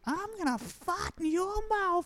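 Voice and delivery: very high, slow Brooklyn woman impression; Brooklyn accent. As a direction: stretch it out in squeaky, hammed-up Brooklynese. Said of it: "She's got that accent".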